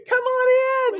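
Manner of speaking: high laughing invitation; laughing welcome. Real, not acted. Mock welcome to newcomers.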